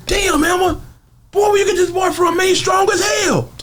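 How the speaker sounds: high amazed yelling; shocked shout. Retold or imitated. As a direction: yell it high in disbelief.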